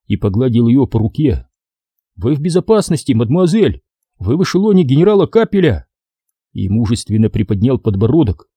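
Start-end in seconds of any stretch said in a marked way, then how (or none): 2.1-5.9 бравый юный солдат. A narrator's reading. Мальчик бодро успокаивает девушку.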